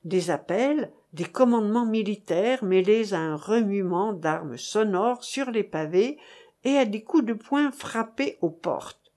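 usual speaking voice

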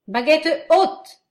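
high, loud battle orders; shouting commands. Acted. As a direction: bark orders to the troops. Officer commanding troops.

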